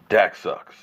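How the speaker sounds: ordinary talk, nothing marked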